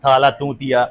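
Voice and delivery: lisped swear words with TH for S and T for CH; childhood lisp. Retold or imitated. Teacher mimics his lisping childhood self swearing, lisped. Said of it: "तोतला था".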